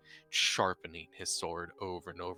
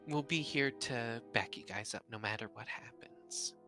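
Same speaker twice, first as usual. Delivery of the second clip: whispering; 4 semitones higher than usual. Acted. Reassuring character line, whispered.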